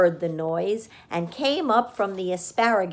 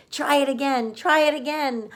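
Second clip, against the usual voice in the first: higher goose voice; goose urging; 7 semitones higher, 4 dB louder, faster than usual. A narrator's reading. The goose urging Wilbur to try again, high voice. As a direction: urge him on eagerly. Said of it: "cried the goose".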